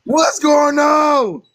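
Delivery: high, stretched-out cry; mock wailing demand. Co-host demanding answers from crying friend, mock wail.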